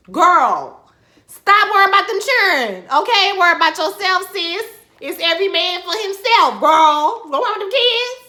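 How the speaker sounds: high, loud hammed-up scolding; collective shout at character. Viewers yelling at Annalise to quit worrying, loud and high. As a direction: yell the advice like the whole audience is shouting it. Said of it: "I feel like we all been saying".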